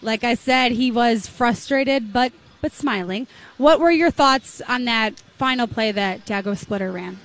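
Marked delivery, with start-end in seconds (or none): none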